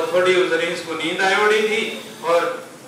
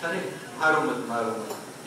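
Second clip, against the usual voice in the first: Sita begging for mercy; 7 semitones lower, 8 dB quieter than usual. Acted. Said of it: "Sita जी उनके ऊपर भी दया करती है".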